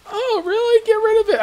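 lightly brushing it off, up high; mock wife.

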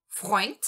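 Freund said with an oi sound and a crisp t; first syllable Freund. Shown as a demonstration. Teacher isolates the syllable Freund for students, clearly. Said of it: "aqui eu vou entonar a parte que eu sublinhei".

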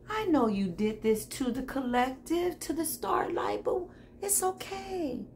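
high, airy half-whisper; mock-sweet forgiveness. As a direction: forgive them softly with exaggerated sweetness. Mock-gracious forgiveness of the rivals.

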